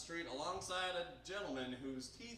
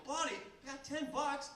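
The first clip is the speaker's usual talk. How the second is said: gleeful invitation to a new buddy; excited; 9 semitones higher than usual. Retold or imitated. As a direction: pitch it up with excitement.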